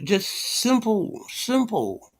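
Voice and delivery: slow, high stretched simple; slow, high sneering simple; drawn-out mock. Real, not acted. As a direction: stretch it out mockingly. Drawn-out mocking.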